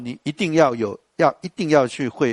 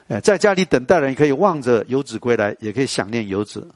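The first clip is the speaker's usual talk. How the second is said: ordinary talk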